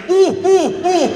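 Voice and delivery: loud high owl imitation; owl hoot. Shown as a demonstration. Telling the crowd to imagine an owl, loud hooting. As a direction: hoot hard in short falling "oohs". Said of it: "Imagine an owl".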